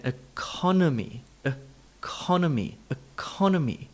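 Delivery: economy as uh-CON-uh-mee; reduced unstressed vowels. Shown as a demonstration. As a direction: stress CON, shrink the other vowels in economy. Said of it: "reducing ... other vowel sounds".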